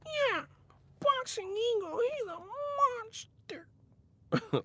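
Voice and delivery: very high falsetto mockery; mouse voice. Imitating critics of his channel in a squeaky mouse voice. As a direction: squeak it like a whiny cartoon mouse. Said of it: "mouse-ass voice motherfuckers".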